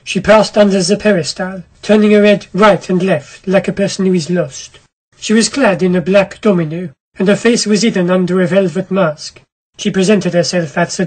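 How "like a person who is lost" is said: ordinary delivery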